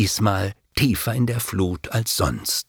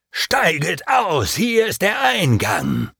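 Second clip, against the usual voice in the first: slow, high bellow from the boat; ferryman shouting; 7 semitones higher than usual. A narrator's reading. Ferryman ordering travellers ashore, high shout. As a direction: haul it out as a rough command. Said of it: "heftig uns anschrie".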